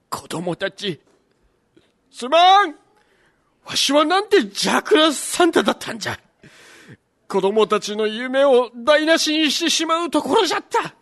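put-on old Santa voice. Santa repents, sets off.

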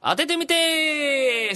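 高く、語尾を長く伸ばしたコール; 番組名を高らかに、ゆっくり引っ張って言う; タイトルコール. Pretend. 番組のタイトルコール. (高い声で伸ばすタイトルコール)

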